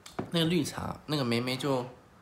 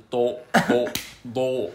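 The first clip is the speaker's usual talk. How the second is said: put-on British accent; 6 dB louder, much slower than usual. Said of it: "用英国腔".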